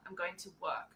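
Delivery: "work" with the R dropped, softly; lazy Australian pronunciation. Shown as a demonstration. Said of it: "As little effort as possible".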